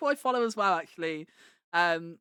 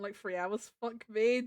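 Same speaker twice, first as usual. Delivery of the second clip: usual speaking voice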